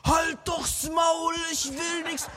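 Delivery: high, slow, furious yell; angry shout. Acted. Petrus, frustrated, about to yell at the man on the shore, angry shout. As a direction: bark it at the stranger. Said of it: "Wollte der gerade so rausschreien".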